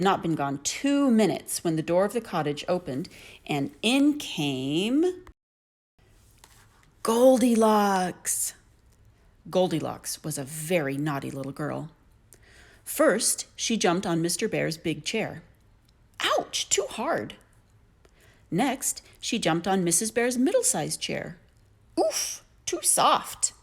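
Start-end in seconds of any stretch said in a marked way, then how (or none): 3.5-8.5 slow, drawn out build to the name; dramatic storybook reveal; suspense reveal. A narrator's reading. 16.2-17.4 put-on high, slow little girl complaint; a fussy girl finding the chair too hard; pouty high ouch; Goldilocks complaining. A narrator's reading. 22.0-23.5 put-on high, slow little girl complaint; a fussy girl finding the chair too hard; pouty high ouch; Goldilocks complaining. A narrator's reading.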